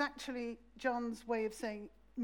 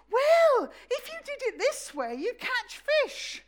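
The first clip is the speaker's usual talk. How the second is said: much higher and louder, patronising advice; smug know-it-all; 12 semitones higher, 10 dB louder than usual. Retold or imitated. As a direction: lecture them like a meddling expert. Know-it-all stranger giving advice.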